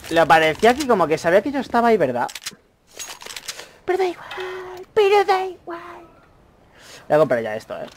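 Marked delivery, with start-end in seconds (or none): 3.8-6.1 high, slow, lilting falsetto; sing-song falsetto. Pretend. Shrugging off the enemy, slow high sing-song. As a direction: sing it off high like it doesn't matter.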